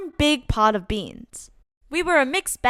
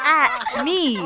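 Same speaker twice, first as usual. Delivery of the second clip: class laughing at him; high mocking laugh around the words; mocking laughter; 3 semitones higher than usual. Classmates laugh at the narrator, mocking.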